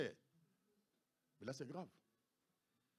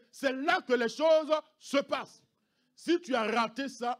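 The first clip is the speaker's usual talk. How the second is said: loud, slow emphatic preaching; fiery preaching; 7 semitones higher, 10 dB louder, slower than usual. Acted. Pastor urging families, loud and slow. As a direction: preach it with full force.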